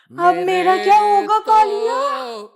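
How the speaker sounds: slow, drawn-out Gabbar Singh menace; Gabbar line. Retold or imitated. Girl fearing she's caught, quoting Gabbar slowly. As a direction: stretch it like the Sholay villain. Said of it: "Sholay line in fear".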